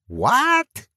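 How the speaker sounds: put-on shrill high squawk of a deaf old woman. A narrator's reading. Deaf old woman squawks at the visitor, high squawk. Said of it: "the old woman squawked".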